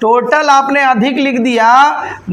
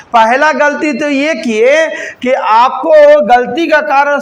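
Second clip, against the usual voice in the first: very slow, each word laid out deliberately; slow, emphatic explaining; slower than usual. Real, not acted. Teacher to class, slowly spelling out the error. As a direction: spell out the mistake word by word, unhurried.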